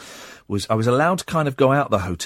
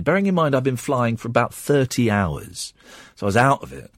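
usual speaking voice

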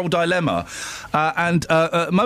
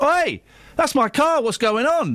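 retold shout; 8 semitones higher than usual. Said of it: "And I ran down and said".

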